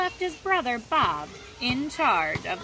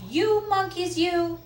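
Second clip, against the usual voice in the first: high, loud telling-off; scolding; 8 semitones higher, 7 dB louder than usual. A narrator's reading. Peddler to the monkeys in the tree, scolding. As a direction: scold them loudly as the peddler. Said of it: "This made the peddler angry".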